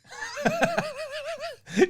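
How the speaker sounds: very high burst of laughter; high squeal of laughter; shrieking laugh. Real, not acted. Loud laugh at the joke.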